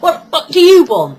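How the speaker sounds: loud, scornful mock of a rude youth; mocking a rude kid; mock rude snap. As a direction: sneer it like a surly teenager. Mocking rude young job seekers.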